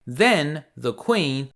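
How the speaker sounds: plain reading voice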